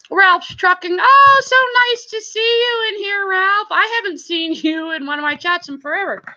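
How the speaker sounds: very high, gushing welcome; real high-pitched delight; delighted greeting. Real, not acted. Delighted greeting of returning viewer.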